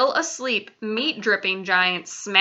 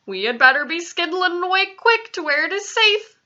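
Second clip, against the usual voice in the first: lightly voiced giant, higher pitch; urgent giant's warning; 9 semitones higher, 4 dB louder than usual. A narrator's reading. BFG urging Sophie to flee to safety, urgent warning. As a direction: urge a hurried retreat, pitch raised. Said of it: "the B F G whispered".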